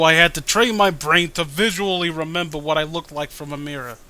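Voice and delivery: ordinary talk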